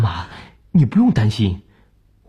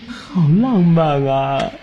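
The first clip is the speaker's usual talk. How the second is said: teasing; 3 semitones higher, much slower than usual. Acted. Son teasing mother about romance.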